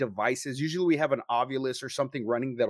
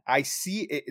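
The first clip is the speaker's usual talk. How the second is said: ordinary delivery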